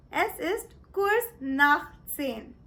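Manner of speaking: careful, slow German; "drei vor zwölf" said slowly and clearly; slow German demo. Shown as a demonstration. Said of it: "Or you can say it as".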